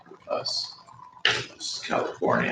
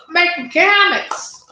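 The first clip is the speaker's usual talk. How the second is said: much higher, louder cartoon voice; high silly voice; 7 dB louder than usual. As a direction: squeal it high and loud.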